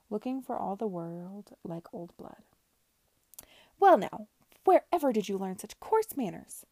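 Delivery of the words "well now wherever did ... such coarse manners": loud, indignant outburst; Madam shrieks at the girl who threw the stew; outraged exclamation. A narrator's reading. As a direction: lose your composure and blurt it. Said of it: "exclaimed Missus Harries, her composure gone".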